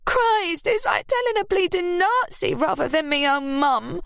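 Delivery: very high, aggrieved complaint; complaining. A narrator's reading. Ruby complaining about her mother to Scarlett. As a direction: grumble at the interrogation. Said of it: "she complained".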